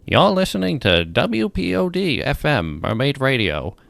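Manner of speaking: upbeat station ident voice; radio announcer. Acted.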